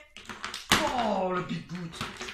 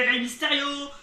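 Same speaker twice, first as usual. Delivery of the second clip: louder, rising commentary call; excited call; 4 semitones higher, 8 dB louder than usual. Real, not acted. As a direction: build the tension as he nearly goes out. Commentator calls near elimination.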